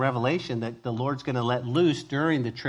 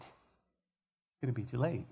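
much softer, slow, sorrowful repeat; sorrowful hush; 3 semitones lower, 12 dB quieter, slower than usual. Real, not acted. Preacher to listeners, grieving softly over the lost. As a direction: let the words sink in grief.

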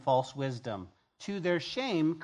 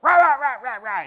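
higher, loud mock rant at home; 8 semitones higher, 13 dB louder than usual. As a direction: bark out a hammed-up angry rant at the wife. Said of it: "be ugly to his wife".

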